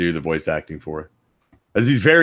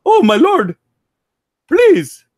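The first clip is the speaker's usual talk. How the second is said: much higher and louder pleading character voice; high, loud, whiny plea; Ramses voice; 8 dB louder, much slower than usual. Acted. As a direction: beg your lord in a squeaky put-on voice. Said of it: "Ramsey Zebo is a little more Middle Eastern".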